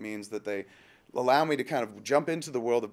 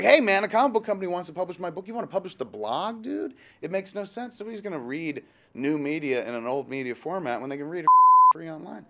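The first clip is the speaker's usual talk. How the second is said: higher-pitched, acted-out protest; retold baffled protest, pitched up; incredulous objection; 6 semitones higher, 7 dB louder than usual. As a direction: argue back in high-pitched disbelief. Acted objection to publishing the blog.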